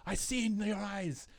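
steel your men's nerve with noble gravity; stirring high king's address; Aragorn speech. Retold or imitated. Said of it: "This is your Aragorn's".

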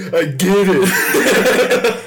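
high, loud, drawn-out hammed-up laugh; guffaw, then stretch the words out; exaggerated imitation of laughing friends; mock guffawing. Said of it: "our friends were like".

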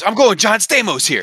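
high, eager shout; excited outburst. Real, not acted. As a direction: announce your new pick in sudden triumph. Seizes on a sudden new pick.

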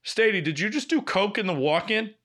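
mock accusing boss question. And she went.

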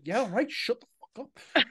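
imitating growing annoyance; mock fed-up brush-off, building; gradual annoyance. Imitating the hero shutting down the crowd, annoyed. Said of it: "he just gradual annoyance".

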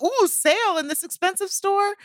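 high, teasing surprise; mock delight. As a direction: gush over a sale. Mock excitement about sale.

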